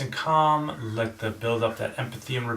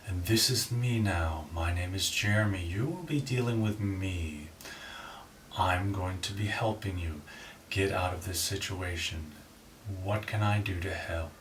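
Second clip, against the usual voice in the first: deep calm DJ reassurance; late-night DJ voice; 4 semitones lower, 7 dB quieter than usual. Shown as a demonstration. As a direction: soothe them like a late-night radio host. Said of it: "nice and low and stern and reassuring".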